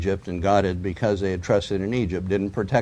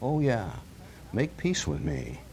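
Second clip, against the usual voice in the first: Satan tempting; 11 dB quieter than usual. Acted. Satan tempting believers in their trials, hushed and coaxing. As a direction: lure them in, hushed and sly. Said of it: "Doesn't that sound like Satan talking".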